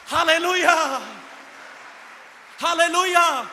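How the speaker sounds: joyful proclaiming shout; loud hallelujah. Real, not acted. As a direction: call it out to the whole hall. Preacher's loud acclamation.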